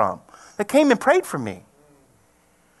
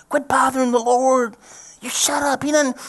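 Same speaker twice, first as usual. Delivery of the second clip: higher, sharp shushing; crowd scolding; 6 semitones higher than usual. Retold or imitated. Crowd telling blind man to shut up, scolding. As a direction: tell him off. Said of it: "they were saying".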